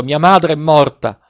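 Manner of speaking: high, slow, anguished cry; desperate cry. A narrator's reading. Boy believing his mother has died, desperate cry. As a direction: cry it out slowly in understated despair. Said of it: "rispose con un altro grido disperato".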